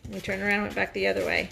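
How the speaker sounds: ordinary delivery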